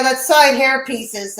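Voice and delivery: ordinary delivery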